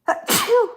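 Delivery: sharp, sudden real cough; loud cough mid-stream. Real, not acted. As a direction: cough it out hard.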